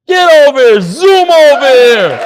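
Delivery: loud, high call across the room; calling out loudly. Real, not acted. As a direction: call him up to the front, slow on zoom. Calling a guest to the front.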